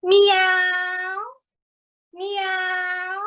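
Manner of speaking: high meow like a cat; high, drawn-out cat meow. Shown as a demonstration. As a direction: mew it out like a little cat. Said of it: "I'd like to hear you making the meowing of the cat".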